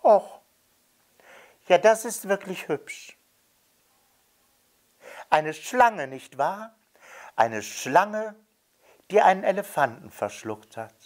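put-on high, delighted little boy; delighted child. A narrator's reading. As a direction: marvel at the picture like a child. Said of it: "der Junge ... sagte dann". (hohe, entzückte Kinderstimme)

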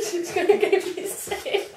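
high wheezy laugh through words. Real, not acted. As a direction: laugh until breathless. Laughing hard.

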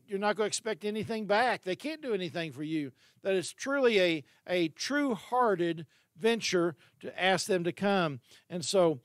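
usual speaking voice

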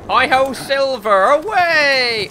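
high hammed-up rallying cry; playful Wild West holler; mock cowboy call. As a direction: call out high like a hero riding off.

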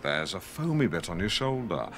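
slow, low and soothing; soothing advert voice. Pretend. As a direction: lull them like a spa ad. Spoof bath advert read.

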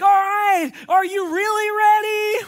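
high, loud drawn-out host tease; teasing TV host. Retold or imitated. Makeover host teasing the family again, high and loud. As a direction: stretch the suspense, shouting. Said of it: "And they're like".

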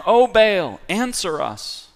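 loud plea, rising to the name; calling out to Baal. Acted. Prophets of Baal crying to their silent god, loud plea. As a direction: cry out to the god for an answer.

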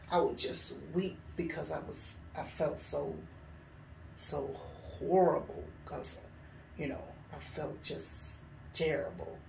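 low, soft, heavy-hearted recollection; subdued remorse. Real, not acted. Recalling months of weeping over sins, subdued and sorrowful. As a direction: drop low and quiet, letting the words sink.

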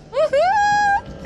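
very high, loud whoop; cheering whoop. Real, not acted. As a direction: whoop with glee at the hit. Cheering a hit.

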